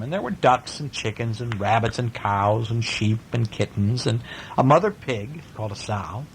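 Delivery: plain reading voice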